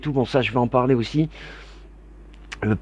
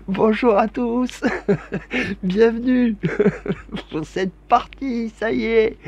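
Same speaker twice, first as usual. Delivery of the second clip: laughing; 7 semitones higher, slower than usual. Real, not acted. Host still laughing at the start.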